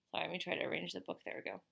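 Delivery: ordinary delivery, nothing marked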